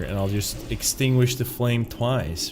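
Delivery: normal talking voice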